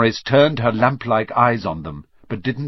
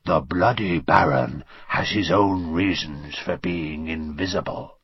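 hoarse whisper; 7 semitones lower, 5 dB quieter than usual. A narrator's reading. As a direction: pose as a fearsome ghost, low and rasping. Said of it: "he said in a hoarse whisper".

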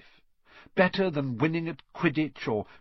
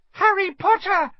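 much higher, louder squeal; squealing girl; 9 dB louder than usual. A narrator's reading. Ginny squeals on seeing Harry Potter. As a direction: squeal his name in delight. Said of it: "she squealed".

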